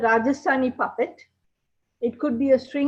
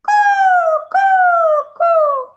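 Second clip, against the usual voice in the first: coo, coo, coo, high and loud like a dove; loud cooing call of a bird; 11 dB louder, much slower than usual. Acted. Puppeteer opening the story with a bird call, loud high coo.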